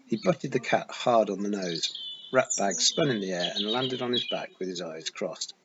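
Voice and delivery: usual speaking voice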